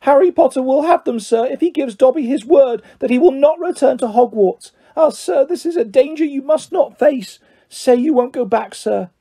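high-pitched elf, beseeching; Dobby, urgent house-elf. Acted. Elf bargains with Harry over letters, high and urgent.

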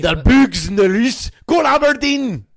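put-on high, loud Scottish accent, building to a shout; shouted mock-Scottish accent. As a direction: holler it in exaggerated Scots. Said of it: "I'm just doing a Scottish accent".

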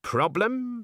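high, feigned surprise; as if no problem existed; mock innocent. Granny to the agent, feigning surprise.

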